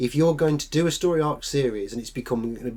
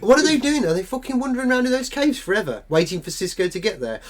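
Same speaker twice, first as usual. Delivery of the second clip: exasperated mock outrage; 7 semitones higher than usual. Mocking the plot, exasperated.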